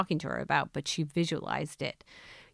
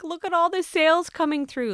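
mock excited cry; 11 semitones higher, 4 dB louder than usual. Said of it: "crying and being like".

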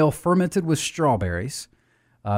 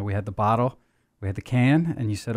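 ordinary delivery

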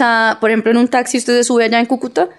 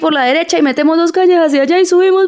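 high mock-crying Santander accent, scolding as it climbs; tearful, scolding Santanderean; 6 semitones higher than usual. Cúcuta taxi driver greeting a passenger, tearful scolding Santanderean accent. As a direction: whine and scold in one breath, Santanderean style. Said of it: "en la misma frase le lloran y le, y lo regañan".

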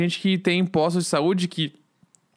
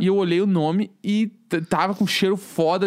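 usual speaking voice

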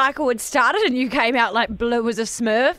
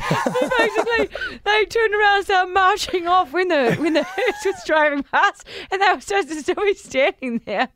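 high-pitched, laughing through the words; helpless laughter; 7 semitones higher than usual. Real, not acted. Explaining a listener's marching mistake to co-host, helpless with laughter. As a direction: struggle to explain through real giggles.

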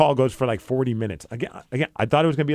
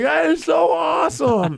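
imitated high, slow excited squeal; thrilled kid; 4 dB louder, slower than usual. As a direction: mimic a kid overjoyed, stretched and high.

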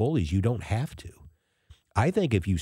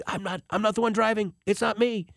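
defensive protest; 11 semitones higher than usual. Retold or imitated. Acted protest at dirty looks.

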